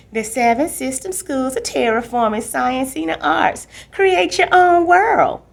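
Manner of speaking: mock a school advert, building big; ad announcer parody. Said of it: "You sound like one of their ads".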